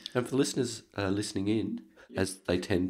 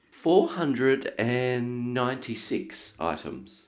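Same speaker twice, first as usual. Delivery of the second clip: slow, drawn-out answer; 3 semitones higher, slower than usual. Real, not acted. Mental arithmetic answer.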